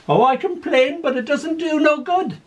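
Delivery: put-on high, far-off little old lady; a dotty gran delighted with her TV. Confused old gran in care.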